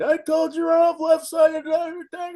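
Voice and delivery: high, loud furious yell at a player; furious coach. Retold or imitated. Coach yelling after the botched run, furious shout. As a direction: bellow at him, spitting mad. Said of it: "he's blowing spit. He's so doggone mad".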